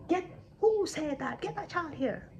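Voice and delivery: mildly hammed-up outrage; king's angry demand. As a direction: demand the culprit as an affronted king. Outraged king demands the child.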